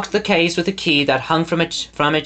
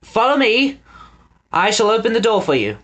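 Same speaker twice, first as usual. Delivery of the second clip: calling out; 7 semitones higher than usual. A narrator's reading. Lord calling out.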